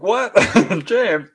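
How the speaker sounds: a high, startled "What?" breaking into a laugh; startled disbelief. Retold or imitated. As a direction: react in amazed disbelief, then say his name. Nephew reveals watching channel.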